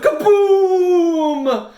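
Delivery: loud high kaboom; a high, loud booming kaboom; explosion shout. A narrator's reading. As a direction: shout the blast. Dynamite explodes in tunnel.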